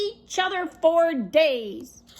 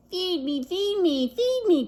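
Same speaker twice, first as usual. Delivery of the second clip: rising, more insistent each time; hungry baby duck; 5 semitones higher than usual. Acted. Hungry duckling to Jack, begging more each time. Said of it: "cried the hungry baby duck".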